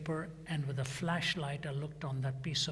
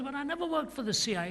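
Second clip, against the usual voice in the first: insistent denial; 10 semitones higher, faster than usual. Retold or imitated. Repeating denial to interrogators.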